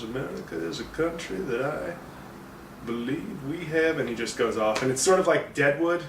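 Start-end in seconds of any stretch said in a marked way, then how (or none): 0.0-4.0 lightly voiced soft, higher Lincoln; imitating Lincoln. Reviewer quoting Day-Lewis's Lincoln, mild impression. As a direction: drift into a rambling statesman voice. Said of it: "just says".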